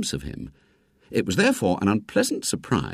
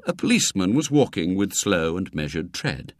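ordinary delivery, nothing marked

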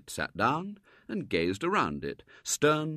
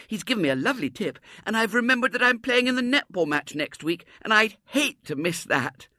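put-on high, louder girlish chatter; joyful little girl; 12 semitones higher, 6 dB louder than usual. A narrator's reading. Little girl to daddy and the boy, high joyful chatter. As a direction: bubble over with delight. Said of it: "gave a cry of joy".

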